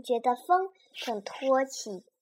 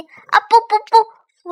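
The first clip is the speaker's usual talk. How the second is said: flustered; 9 semitones higher, 13 dB louder than usual. Acted. Pterosaur catches a slip.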